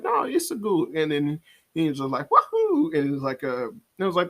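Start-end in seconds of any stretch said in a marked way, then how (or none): 0.0-0.9 high-pitched cartoon character voice; imitated cameo character. Quoting the original Mario voice actor's cameo, high imitation. 2.3-2.9 very high, loud cartoon whoop; imitated game catchphrase. Mimicking the original voice's cameo in the movie, high whooping imitation.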